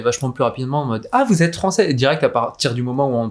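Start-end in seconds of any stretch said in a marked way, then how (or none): 1.1-1.9 high-pitched "oh, you're French!" reaction; imitated exclamation. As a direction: mimic someone spotting it instantly. Acts out locals spotting the French.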